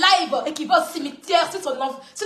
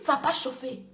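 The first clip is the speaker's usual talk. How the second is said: quiet taunt; 6 semitones lower, 7 dB quieter than usual. Shown as a demonstration. Telling the rival it didn't heat up, softly dismissive. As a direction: lower and softer, dismissive.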